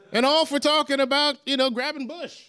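high, teasing delivery; sly punchline. Acted. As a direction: lean into the innuendo. Punchline with raised pitch.

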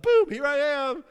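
mock triumphant boom. World's view of sudden success.

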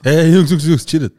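calling out. Retold or imitated. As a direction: shout the boys apart. Acting out breaking up fight. (lauter Ruf, um die Jungs zu trennen)

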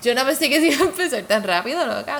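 real astonishment spilling over; excited. Real, not acted. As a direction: blurt it out in amazement. Amazed at how fast it started.

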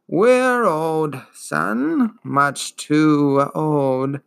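put-on slow, wheezing, world-weary old beast; weary ancient turtle. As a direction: let every word sag with ancient boredom. Turtle's long weary speech.

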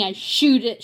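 plain reading voice

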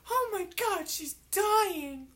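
high, loud mock panic from the audience; panicked crowd. Retelling the film's ending, friend mimics a panicked audience. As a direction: shriek it like a horrified onlooker. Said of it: "and everybody's like".

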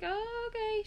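high, soft mock agreement; placating reply. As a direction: lilt it high and soft, imitating a friend. Acting out the friend being woken.

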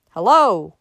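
loud hail toward the house; long, falling shouted hello; calling out. A narrator's reading. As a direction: holler for anyone at home. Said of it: "he called again".

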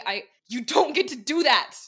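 loud, high, anguished yell; screaming. Acted. Dekai screaming at Lucy for risking her life, loud and raw. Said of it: "he screamed".

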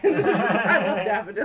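loud laughing declaration, slowed. Real, not acted. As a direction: laugh through the words. Laughing protest over daffodils.

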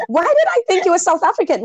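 high, laughing apology; embarrassed laugh. Real, not acted. Embarrassed at wrong nationality.

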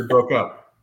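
usual speaking voice

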